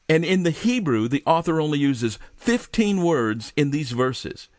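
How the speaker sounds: ordinary delivery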